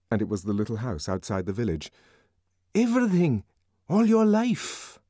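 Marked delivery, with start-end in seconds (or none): 2.7-5.0 Bernard's voice. A narrator's reading. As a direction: urge him to tell everything. Bernard urging.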